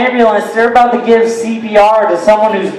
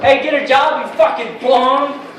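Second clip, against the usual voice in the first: higher-pitched heckle without full volume; mock yell; 6 semitones higher, 4 dB quieter than usual. Comic retelling yelling at a man in a suit, mock yell. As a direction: imitate yelling at a passer-by, held back. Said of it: "and I yelled at him".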